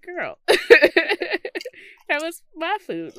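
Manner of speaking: high, loud, laughing outburst; laughing protest. Retold or imitated. Host retells scolding someone over stolen food, laughing protest. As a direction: protest through helpless laughter.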